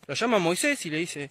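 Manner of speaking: ordinary talk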